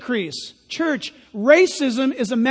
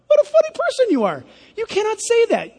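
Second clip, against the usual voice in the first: mocking high voice; 9 semitones higher, faster than usual. Mocking the humanist's objection.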